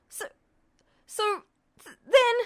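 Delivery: high, broken stammering; tearful stammer. Acted. Ed stammers at Justin, near tears. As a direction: choke on the words.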